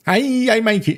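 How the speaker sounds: high, badly faked Swedish accent; a hammy first try at a Swedish accent. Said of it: "this is what's kind of like a Swedish accent".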